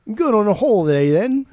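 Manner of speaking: put-on loud, high-pitched caricature of an English accent; hammed-up all-purpose European accent; mock European accent. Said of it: "That's how all Europeans sound to me".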